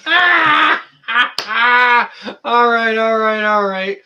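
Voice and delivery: high-pitched words squeezed through laughter; laughing. Real, not acted. Streamer cracking up at a chat joke, laughing. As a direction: get the words out while cracking up.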